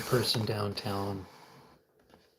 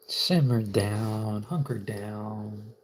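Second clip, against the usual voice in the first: slow calming; much slower than usual. Acted. Slow soothing to self.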